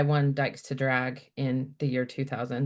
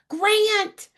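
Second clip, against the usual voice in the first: high, loud call to the other room; calling out to grandpa; 6 dB louder than usual. Retold or imitated. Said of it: "She goes".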